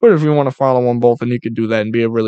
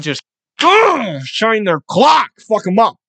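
pumped up; 7 semitones higher, 3 dB louder, slower than usual. Pretend. Imagined tackle hit.

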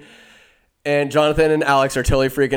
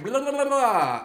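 high babbled gibberish spell; mock incantation; 9 semitones higher than usual. Storyteller plays Emotep with his urn, high mock incantation. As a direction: babble a pretend curse up high.

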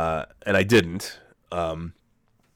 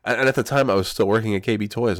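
ordinary talk, nothing marked

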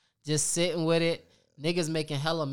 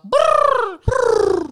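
loud, high rolled brrr; bird trill; 12 dB louder than usual. Acted. Bird Talk show ad-lib intro.

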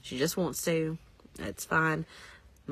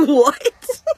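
high, loud laughing What; shocked what; 8 semitones higher, 12 dB louder than usual. Retold or imitated. Kids hearing their real last name, laughing shock. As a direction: squeal it out in mock disbelief. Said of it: "they were like".

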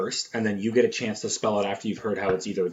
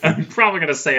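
laughing; 5 semitones higher, 6 dB louder, faster than usual. Real, not acted. Laughing while joking.

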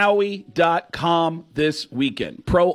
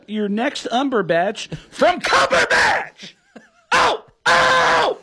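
Sam Kinison impression; 6 semitones higher than usual. Comic doing Sam Kinison for the hosts, building to a scream. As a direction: rant then scream it out. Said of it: "this one is going to be Sam Kinison".

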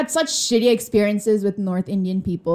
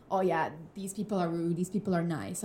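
lower, softer mock judging; dismissive generalizing; 4 semitones lower, 10 dB quieter than usual. Voicing people who judge cultures, low and offhand. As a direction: toss it off quietly as others' opinion.

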